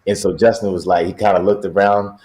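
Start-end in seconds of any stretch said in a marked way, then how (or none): none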